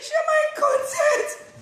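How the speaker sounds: very high, loud stretched wail; mock crying. Vlogger begging for shares, hammed-up crying wail. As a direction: wail it like a pretend sob. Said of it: "Don't think I'm crying".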